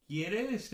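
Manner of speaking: "quieres" pitched high and rising; question intonation. Shown as a demonstration. Teacher begins a sample question, "quieres" rising high. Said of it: "esa entonación de la pregunta".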